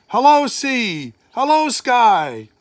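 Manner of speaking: high, loud, slowed calls of welcome; joyful greeting. A narrator's reading. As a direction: greet the sea and sky with wonder. God greets creation joyfully.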